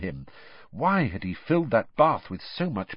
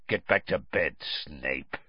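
Moody's low growly voice, dropping softer; low dismissive order; 8 semitones lower, 4 dB quieter than usual. A narrator's reading. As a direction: send him off, pitched low. Moody orders Snape off after laughing.

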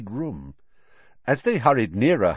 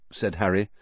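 ordinary delivery

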